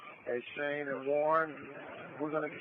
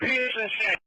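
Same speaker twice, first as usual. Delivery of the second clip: loud higher shout; urgent firefighter radio; 8 semitones higher, 7 dB louder than usual. Real, not acted. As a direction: bark the location. Engine repeats location urgently.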